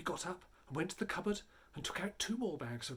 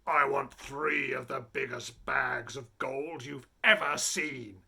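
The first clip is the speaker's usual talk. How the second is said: louder, slow, relishing robber chief; greedy robber chief; 3 semitones lower, 5 dB louder, slower than usual. A narrator's reading. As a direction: roll out the boast with greed. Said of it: "the chief of the robbers said".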